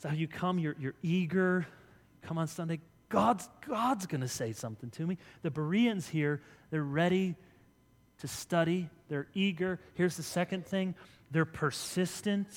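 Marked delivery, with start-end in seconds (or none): none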